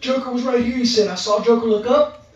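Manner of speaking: high, excited breathless telling; a man amazed by what he just saw; awed excitement. Retold or imitated. Client telling the preacher the demon vanished, high awed excitement.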